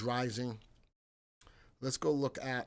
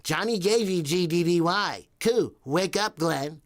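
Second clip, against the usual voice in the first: imitating Janice; 5 semitones higher, 11 dB louder than usual. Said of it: "That's the exact voice she just said".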